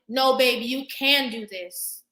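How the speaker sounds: slow, emphatic "you can do this"; firm pep talk. Retold or imitated. Wife reassuring her doubting man, slow and firm. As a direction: lean on each word to lift him up.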